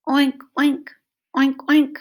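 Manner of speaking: loud, high snorting oinks; pig oink. Retold or imitated. Reading to children, loud pig oinks. Pig noise imitated loudly.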